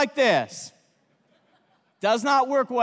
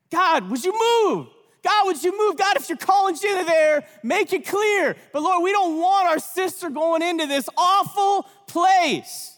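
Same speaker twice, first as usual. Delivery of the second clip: fervent retold prayer, a little higher; praying aloud; 4 semitones higher than usual. Friends praying outside the club, in a fervent raised prayer voice. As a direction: plead with God like a prayer meeting. Group prayer walk acted.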